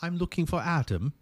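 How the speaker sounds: light British accent, imitating a caller. Receptionist retelling caller in a mild British accent. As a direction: put on a hint of a British accent. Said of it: "had a British accent".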